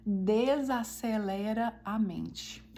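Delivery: slow, drawn-out emphatic delivery; slowed right down. Shown as a demonstration. Teaching listeners that reading aloud calms, slowed right down. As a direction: stretch each word out very slowly. Stretching words to show slowing down.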